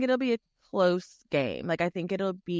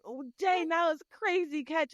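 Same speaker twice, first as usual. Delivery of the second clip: higher, hyped-up imitation of a boyfriend watching football; excited fan; 9 semitones higher than usual. Girlfriend imitating her boyfriend's excited football reaction. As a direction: mimic him gushing over a catch, pitched up. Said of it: "He's like".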